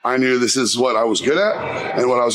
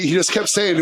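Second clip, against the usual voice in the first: ordinary delivery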